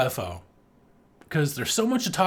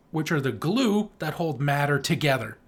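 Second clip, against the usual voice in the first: usual speaking voice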